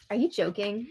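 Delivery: softly incredulous question; quiet disbelief. Real, not acted. Viewer reacts to cameras switching, quiet disbelief. Reacts to feeds cutting, two takes.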